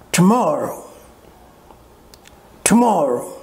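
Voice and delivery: Tomorrow with a long, prominent MOR and light ending; stress on MOR. Shown as a demonstration. Teacher showing how to pronounce it, stressed second syllable. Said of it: "will be higher than the others".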